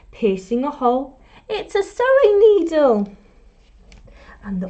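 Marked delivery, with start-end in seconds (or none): 1.5-3.1 much higher and louder reveal; excited reveal. A narrator's reading. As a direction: announce the discovery with delight. Revealing the tool.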